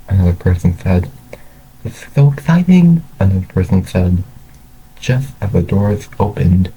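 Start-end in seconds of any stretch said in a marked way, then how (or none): 1.8-3.0 high-pitched, loud thrill; excited. A narrator's reading. Another person in the crowd before the opening, excited. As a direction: gush with eager excitement.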